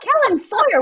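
loud, high scolding with full name. Real, not acted. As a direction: call them out by full name, laughing. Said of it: "[name] is using my whole name".

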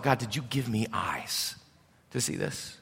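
a stunned private plea, barely voiced; hushed anguished prayer. Man imagined praying in anguish.

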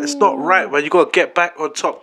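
ordinary talk, nothing marked

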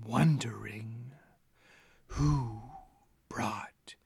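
slow, breathy whisper from the shadows; eerie whisper. Acted. Hallway figure whispers to the party, eerie and slow. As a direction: whisper it like a lurking presence.

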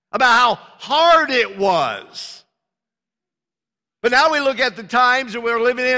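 fervent preacher rising to a peak; preaching at full force. Acted. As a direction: drive the point home to the congregation.

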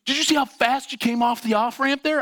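high-pitched, indignant accusation; worked-up mock road rage; angry driver. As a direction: imitate an outraged driver demanding an answer. Angry driver accusing him, retold.

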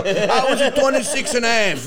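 loud, mocking quote with laughter; mocking grown man. Son ribbing dad about counting half years, mocking imitation. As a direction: parody a man proudly counting his half year. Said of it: "I've never heard a grown man go".

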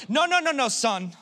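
high-pitched run of no's, dropping on the last word; imitated exasperated father; correcting dad. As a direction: cut your child off in mock reproof. Father cuts off arguing son.

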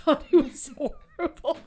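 high, loud laugh through the words. Real, not acted. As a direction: laugh it off, embarrassed. Laughing at own crying story.

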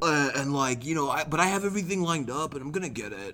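put-on voice of an excuse-maker; mocking tattoo procrastinators. Mimicking people who talk about tattoos for years, put-on excuse-making voice. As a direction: imitate someone stalling with earnest excuses. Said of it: "that's how they sounded".

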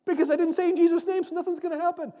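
high, loud, hurried fretting voice; hammed-up anxious churchgoer; mock panicked believer. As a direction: play a flustered believer fixing his prayer. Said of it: "they'll go".

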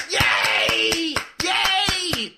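delighted cheer as Kenan arrives. Real, not acted. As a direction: celebrate his arrival. Co-host finally joins.

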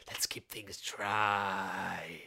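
slow secret whisper. Retold or imitated. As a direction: hush it like a mantra. Mock mantra about keeping dry.

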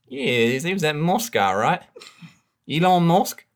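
put-on broad Aussie accent, chatty; Australian accent. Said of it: "such a brilliant Australian accent".

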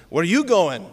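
movie-Scot challenge; Scottish accent. Acted. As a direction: ask it in a hammy Scottish accent. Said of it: "his friend Hamish says".

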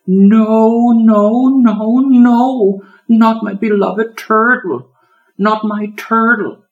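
high, loud desperate cries; a child watching her pet attacked, high and wailing; panicked wailing. A narrator's reading. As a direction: plead in horror, slowing. Girl panics over her turtle.

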